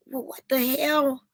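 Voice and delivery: retold disbelief at a strange sight; baffled. Retold bafflement at what she sees.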